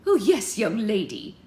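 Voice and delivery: loud, high Scottish figurehead; old Scottish statue. Acted. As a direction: answer her heartily in a Scottish brogue. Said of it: "said the one who'd addressed her first".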